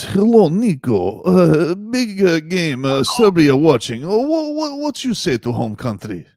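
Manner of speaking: put-on slow, broken Serbian accent; lightly put-on Serbian accent. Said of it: "pretend to be Serbian".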